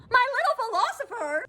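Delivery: very high, mocking squeal of incredulity; appalled disbelief. Real, not acted. Repeating the date's pet name to viewers, appalled. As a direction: echo it way up high, aghast.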